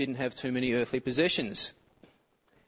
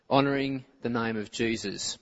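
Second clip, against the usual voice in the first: usual speaking voice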